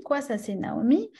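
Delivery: normal talking voice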